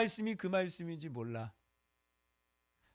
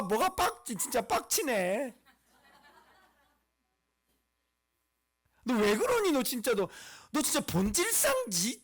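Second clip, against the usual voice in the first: raised, swooping, indignant rant; exasperated scolding; 4 semitones higher, 4 dB louder than usual. Acted. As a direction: scold them in hammed-up disbelief. Preacher scolding the fearful complainers.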